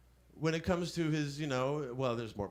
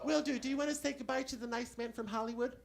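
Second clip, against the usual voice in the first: much higher, prim woman's voice; secretary voice; 10 semitones higher than usual. Acted. Comic poses as Caine's secretary to the scammer, high prim woman. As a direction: pitch way up as a fussy secretary. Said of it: "Barbara Johnson".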